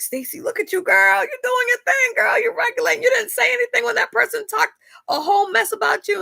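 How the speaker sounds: put-on high, cheery hype-girl voice cheering herself on; pep-talk yourself like a proud best friend, way up high; self hype voice. Cheering herself on for staying silent, in a high hype-girl voice. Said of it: "I really thought that I was doing this great regulation work".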